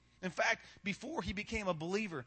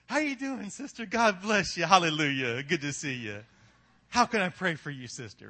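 hammed-up, booming hallelujah greeting; fake church cheer; 3 semitones lower, 6 dB louder than usual. Fuming churchgoer greets a sister at the door, fake holy cheer.

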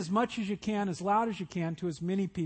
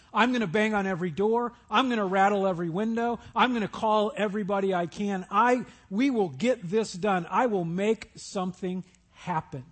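loud, pushy vow to force things through; stressed, determined rant; 5 dB louder than usual. Acted. Preacher voicing a stressed waiter, loud and driven. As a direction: rattle it off like someone who must make it happen.

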